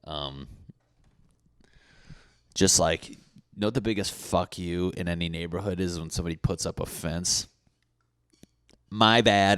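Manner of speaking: usual speaking voice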